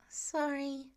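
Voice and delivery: a lightly voiced, half-hearted apology; sheepish. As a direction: say sorry without really meaning it. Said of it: "he says sheepishly".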